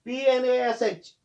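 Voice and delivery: usual speaking voice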